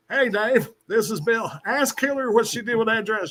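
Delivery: prank call as Bill; lightly voiced ex-president; Bill Clinton impression. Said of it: "I do a lot of impersonations".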